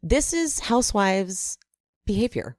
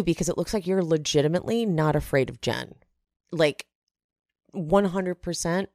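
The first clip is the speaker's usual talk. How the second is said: ordinary talk, nothing marked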